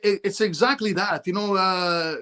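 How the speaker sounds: ordinary delivery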